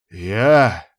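rising indignant outburst; flaring up. Acted. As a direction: flare up in disbelief. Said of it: "взвился Муса ещё больше".

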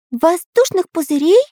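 can't believe the idea; puzzled question. A narrator's reading. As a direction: Pencil baffled by air bubbles. Pencil puzzled.

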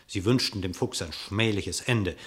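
ordinary talk

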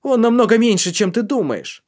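high, loud cry; a feverish boy yelling; shouting out. Acted. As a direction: blurt it out at full voice. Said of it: "выкрикнул мальчишка".

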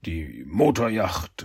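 slow, low, drawn-out "Motorjacht"; stalling hesitation. Acted. Shredder caught out about the rented boat, hesitant and slow. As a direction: drag out "Die Motorjacht" as if searching for words.

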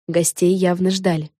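ordinary delivery

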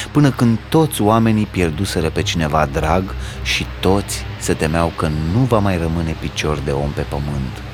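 ordinary delivery

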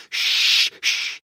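long hushing sounds; repeated shushing. A narrator's reading. Hagrid silences Harry's questions, long hushes. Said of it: "قال هاغريد مرة أخرى".

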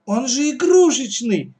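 loud, high protest; high-pitched childish indignation; indignant. Acted. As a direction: protest like an outraged girl. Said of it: "возмутилась Катя".

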